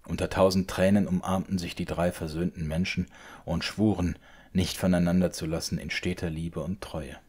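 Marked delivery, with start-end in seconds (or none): none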